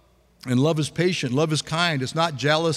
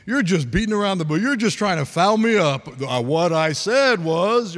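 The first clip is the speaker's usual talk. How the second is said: mock argument, pushing louder as it goes; exasperated arguing; 3 semitones higher, 4 dB louder than usual. Preacher acting out someone defending their point, exasperated. Acted quarrel over being questioned.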